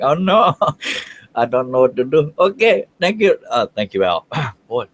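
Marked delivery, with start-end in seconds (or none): 0.0-2.3 high, hammed-up comic distress; goofy voice, mock alarm; silly put-on voice. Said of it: "the voices are starting". 2.4-3.4 high-pitched goofy thanks; silly put-on voice. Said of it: "the voices are starting".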